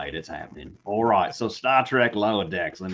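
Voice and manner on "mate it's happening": put-on, low-pitched Australian accent; Aussie accent. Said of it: "in an Aussie accent".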